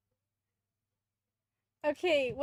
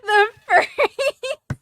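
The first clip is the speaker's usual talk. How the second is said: high shrieking laugh; 9 semitones higher, 14 dB louder than usual. Real, not acted. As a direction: squeal with laughter.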